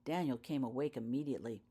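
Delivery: ordinary delivery, nothing marked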